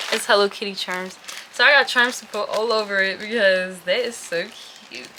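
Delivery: ordinary talk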